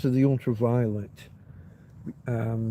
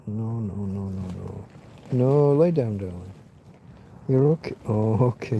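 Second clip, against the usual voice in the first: hushed, low coaxing; 4 semitones lower than usual. Real, not acted. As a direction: coax her to lie down. Settling the dog down.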